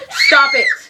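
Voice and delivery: loud laughing protest; laughing shout. Real, not acted. Laughing at the child, shouting stop it. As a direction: yell it through a laugh.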